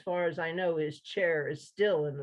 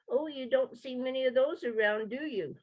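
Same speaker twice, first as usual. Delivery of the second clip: higher, pinched, tiny-mouthed squeak; small-mouthed frog; 7 semitones higher than usual. Acted. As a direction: say it with lips pursed tiny. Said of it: "made its mouth as small as possible".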